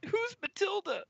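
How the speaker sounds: put-on very high, squeaky boy voice; put-on high, puzzled voice; timid young helper. Young helper answers customer, high timid voice.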